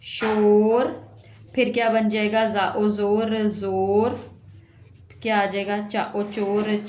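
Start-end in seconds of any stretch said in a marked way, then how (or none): 0.0-1.2 बहुत धीमे "शोर"; शोर को खींचकर दोहराओ; 1 stretched word. Shown as a demonstration. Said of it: "शा ओ शोर". (बच्चों को समझाते हुए खिंचा शब्द)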